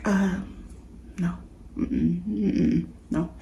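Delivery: soft, shy, hesitant refusal; timid no, said softly in the dream. Retold or imitated. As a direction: shrink back and mumble no. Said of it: "being timid and being super humble".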